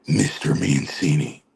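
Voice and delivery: slow, low imitated address; Chief. Chief closing his telling-off, imitated slow low voice. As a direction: drop low and space out the name. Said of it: "I can hear him say it now".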